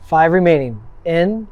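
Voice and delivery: calm, firm breathing coach; guided breathwork cues. Acted. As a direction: count them through each breath.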